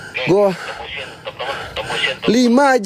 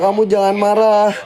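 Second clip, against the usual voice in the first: ordinary talk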